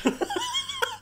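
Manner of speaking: high burst of giggles; real laughter. Real, not acted. Cracks up after smoke break.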